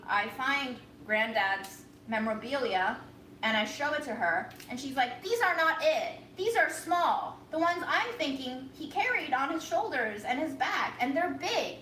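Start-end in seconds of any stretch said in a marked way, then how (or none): 5.2-11.8 angry grandma. Retold or imitated. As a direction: imitate grandma insisting they're too small. Grandma furious about medallions.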